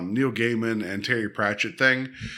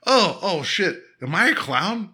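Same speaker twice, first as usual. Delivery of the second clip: higher-pitched comic startled realization; mock dismay; 5 semitones higher than usual. Demon discovering he looks like a clown, mock dismay. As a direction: imitate a demon baffled by himself.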